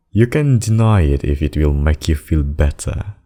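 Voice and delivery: usual speaking voice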